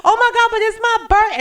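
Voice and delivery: high, loud, fast wail; screaming complaint. Retold or imitated. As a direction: wail that it's your birthday. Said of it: "I was screaming at the top of my lungs".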